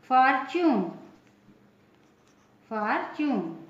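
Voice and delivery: "फॉर्च्यून" with TU said as a ch sound; "फॉर्च्यून" demonstrated with a clear ch. Shown as a demonstration. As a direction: say "फॉर्च्यून" as FOR-chyoon, teacher's model. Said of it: "च होगा".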